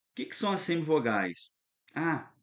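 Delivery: normal talking voice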